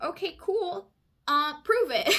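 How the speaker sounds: high, loud, sassy prove it; mock bank clerk. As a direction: throw the demand back with attitude. Bank's demand, mocked.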